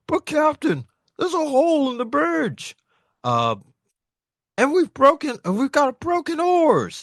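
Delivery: high, loud alarmed sailor; high panicked giant. Acted. Recapping manga to friends, panicked crewman shouting high.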